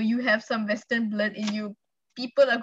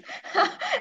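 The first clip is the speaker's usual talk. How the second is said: high giggle. Real, not acted. Laughs at the sad topic.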